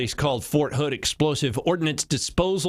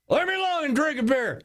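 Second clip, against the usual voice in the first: high, strained mock grumble; irritated dad; 12 semitones higher than usual. Dad drinking beer waves son away, irritated. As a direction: brush the kid off, voice pitched up.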